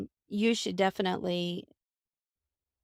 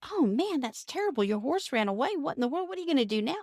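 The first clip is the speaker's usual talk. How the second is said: higher, a hint of a worried neighbor; fretting neighbor; 6 semitones higher than usual. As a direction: lightly play the alarmed neighbor. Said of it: "And he goes".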